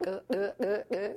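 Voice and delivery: imitated glugging from a bottle; throaty glug, glug, glug; gulping noises. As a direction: mimic swallowing in repeated gulps. Mimes gulping a bottle of poison.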